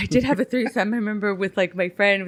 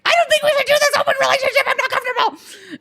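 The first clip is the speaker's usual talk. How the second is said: much higher, fast, frantic mock rant with a laugh; mock frantic outburst; faster than usual. Laying into her husband, hammed-up high fast rant. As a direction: rattle it off high and panicked. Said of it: "being like".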